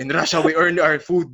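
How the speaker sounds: laughing stern Russian voice; mock Russian trainer; heavy russian accent menace.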